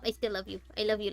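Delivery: ordinary talk, nothing marked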